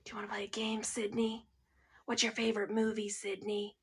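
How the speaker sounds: creepy, hushed killer taunt; Ghostface. Pretend. Ghostface taunting Sydney by phone, hushed menace. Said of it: "He's like".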